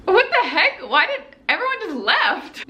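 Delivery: high-pitched baffled protest; indignant disbelief. Retold or imitated. Retold disbelief at being abandoned.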